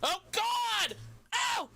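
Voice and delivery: sudden high yelp, oh God then ow; real startled ouch, shot up high; startled yelp of pain. Real, not acted. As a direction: jolt up high as if just scratched. Sudden pain, cat scratch.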